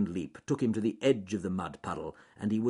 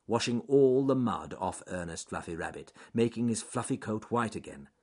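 usual speaking voice

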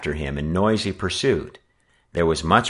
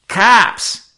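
very high, loud breathless cry; panted warning; 10 dB louder than usual. A narrator's reading. Scout to the gang, loud panting cry. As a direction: alarm the gang. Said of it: "he panted".

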